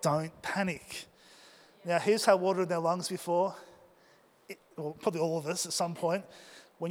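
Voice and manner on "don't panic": slow, hushed self-reassurance; inner self-talk. Retold or imitated. Diver with water getting in coaching himself, hushed inner voice. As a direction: say it softly and slowly to yourself. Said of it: "and I thought".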